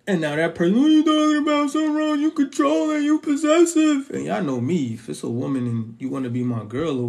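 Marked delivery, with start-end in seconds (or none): 0.8-4.1 much higher, louder mocking outrage; shrill up into a hammed-up accusation; mock outraged partner. The partner overreacting to his calm words, high mock outrage.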